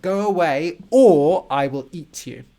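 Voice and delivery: loud, hammed-up monster roar of a threat. Shown as a demonstration. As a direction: play the monster scaring someone off. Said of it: "Maybe like a monster".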